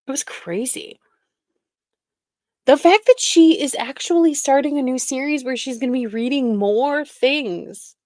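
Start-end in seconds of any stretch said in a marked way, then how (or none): 2.7-7.9 excited disbelief. Real, not acted. Laughing disbelief at series.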